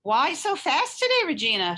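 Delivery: put-on high, loud call to a passing girl; cheerful peddler shouting; peddler's shout. A narrator's reading. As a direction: shout it across the street. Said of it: "shouted one of the peddlers".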